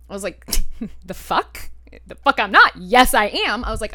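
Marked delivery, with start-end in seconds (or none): 1.1-3.6 retold indignant comeback, rising louder; indignant retort. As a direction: fire back in mock disbelief, then insist. Retold defiant retort to boyfriend.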